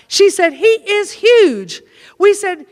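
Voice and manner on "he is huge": HUGE stretched out, high and falling; awed emphasis. Retold or imitated. Susie describes Clarence to the girls, slow high awed "huge". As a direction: draw out "huge" in mock awe, high pitch.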